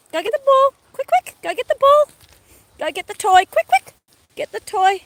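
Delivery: very high, loud "go get the ball"; squeaky dog voice. Real, not acted. Sending the dog to fetch.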